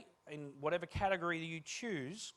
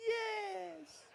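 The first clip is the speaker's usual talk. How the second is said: high squeak. Retold or imitated. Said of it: "I said".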